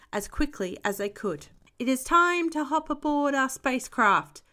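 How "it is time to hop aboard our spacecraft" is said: higher, lightly called announcement; mild storybook call-out. A narrator's reading. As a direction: call the crew aboard. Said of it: "called Grampy Rabbit".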